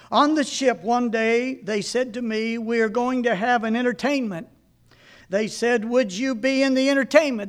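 put-on reading an old sermon aloud to a congregation. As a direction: read it as a preacher retelling his own story.

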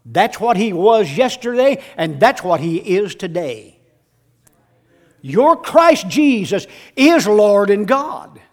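loud, high, ringing sermon cry; shouting the Thomas story from the pulpit; full-force preaching. Acted.

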